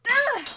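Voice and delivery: sharp loud cry of frustration; a loud exasperated yell; frustrated yell. Acted. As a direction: let out a loud angry shout. Frustrated outburst alone.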